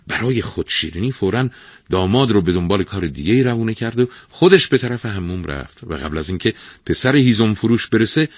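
usual speaking voice

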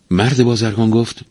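plain reading voice

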